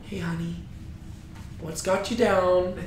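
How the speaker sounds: hushed, tender wifely concern; motherly. Acted. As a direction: soothe him like a nice mom. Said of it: "this, like, nice little motherly figure".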